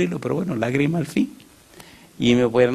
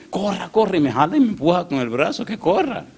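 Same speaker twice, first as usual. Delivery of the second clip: urgent push; 7 semitones higher than usual. Retold or imitated. Man pushing pastor to run.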